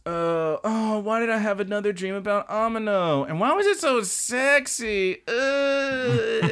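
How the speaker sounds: high, breathless schoolgirl voice, waking from a steamy dream; high flustered girl just woken up; flustered anime girl. Retold or imitated. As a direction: squeal it out, embarrassed and drowsy. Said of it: "and she goes".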